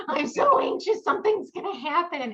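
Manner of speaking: high, loud, wailing mock panic; hammed-up high weepy wail; retold sobbing. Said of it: "I was just sobbing".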